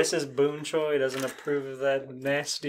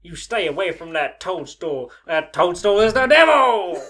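mock warning; 4 semitones higher, 7 dB louder than usual.

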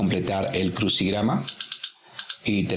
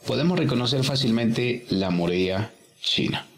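ordinary talk, nothing marked

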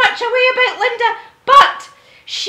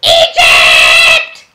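shouted answer; 6 dB louder than usual. Acted.

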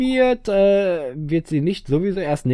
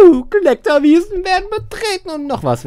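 very high, silly cheer; gleeful whooping; 8 dB louder than usual. Pretend. Player cheering the trip to the meadows, high whoop.

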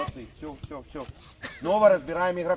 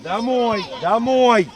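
loud calls across the pitch; yelling across the field; 2 semitones higher, 9 dB louder, much slower than usual. Real, not acted. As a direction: shout it down the field. Coach calling players back.

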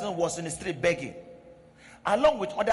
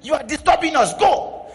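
scolding disciples; 8 dB louder than usual. Retold or imitated. Disciples driving the woman away.

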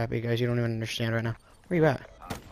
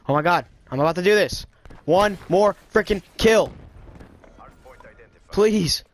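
rising nervous excitement; excited pleading; 7 semitones higher, 6 dB louder than usual. Real, not acted. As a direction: beg the game for one more kill. One kill from camo.

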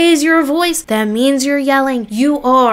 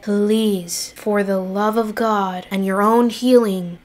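ordinary talk

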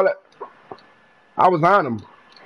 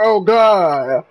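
ordinary talk, nothing marked

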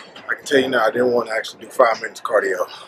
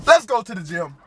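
excited; 10 semitones higher, 4 dB louder than usual. Real, not acted. Hyping up the gym trip.

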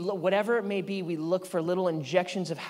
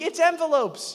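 high, louder fake thrill; put-on excitement; 11 semitones higher, 6 dB louder than usual. Dad faking excitement for his daughter, high. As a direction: hype it up for a kid. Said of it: "try to get excited for my daughter".

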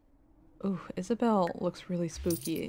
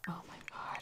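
softer whispered oh my god; exasperated whisper; 18 dB quieter than usual. Real, not acted. Streamer whispering in exasperation over a misclick. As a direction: mouth it in despair.

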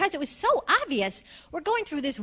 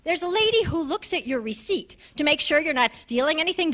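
ordinary talk

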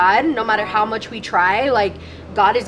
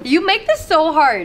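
real tearful complaint, pitched up; emotional; 5 semitones higher, 3 dB louder than usual. Real, not acted. Overwhelmed friend telling the group it's hard, emotional. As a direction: voice lifting as tears well up. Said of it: "it makes me so emotional".

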